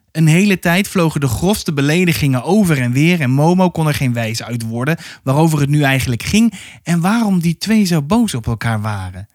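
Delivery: ordinary delivery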